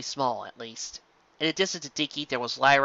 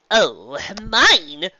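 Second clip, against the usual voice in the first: louder, higher boast; showy claim; 5 semitones higher, 7 dB louder than usual. A narrator's reading. Trixie claiming her glowing gift, loud and showy. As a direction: announce it's yours with flair. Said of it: "Trixie said".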